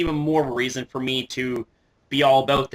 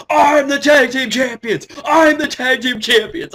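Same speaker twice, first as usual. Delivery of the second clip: high, loud mock bickering shout; imitated yelling; 11 semitones higher, 8 dB louder than usual. Imitating two tag partners yelling at each other, mock shouting. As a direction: yell it like squabbling wrestlers. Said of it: "two of them yelling at each other".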